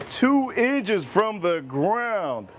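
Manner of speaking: slow and deliberate, every word stretched; slow drawn-out. Shown as a demonstration. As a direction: drag out the phrase. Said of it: "repeats slowly".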